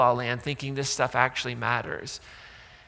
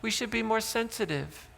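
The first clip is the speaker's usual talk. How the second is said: higher, soft, mock-sympathetic whine; mock sympathy; 6 semitones higher, 7 dB quieter than usual. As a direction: fake pity, high and hushed. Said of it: "And Jesus answers".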